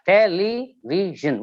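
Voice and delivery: "television" slow, in four distinct beats; syllable by syllable. Shown as a demonstration. Teacher showing four syllables, syllable by syllable. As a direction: sound out "television" as te, le, vi, sion. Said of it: "we have four syllables".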